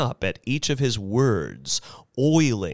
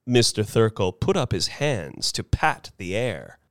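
ordinary delivery

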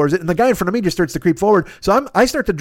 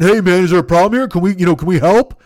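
mock swagger, louder put-on voice; 4 dB louder than usual. Beanpole passengers walking up to a fight, mock swagger. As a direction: imitate a cocky would-be helper butting in. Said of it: "literally with swagger".

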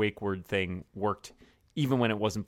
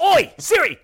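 loud, high British shout of Oi Siri; cockney Oi; 13 dB louder, slower than usual. Retold or imitated. Host one-ups the British Siri joke with a loud cockney call. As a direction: bark it like a London bloke. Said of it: "if it's, like, British here".

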